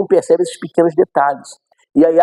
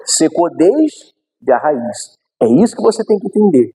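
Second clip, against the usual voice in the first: usual speaking voice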